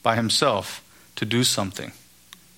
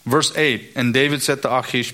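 ordinary delivery

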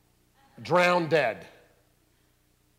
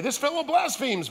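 indignant scribes; 11 semitones higher, 5 dB louder than usual. Acted. Scribes accusing Jesus.

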